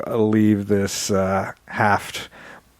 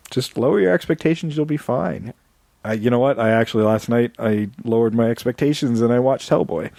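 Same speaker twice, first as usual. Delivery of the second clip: usual speaking voice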